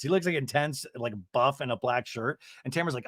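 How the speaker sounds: ordinary delivery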